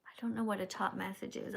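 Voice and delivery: ordinary delivery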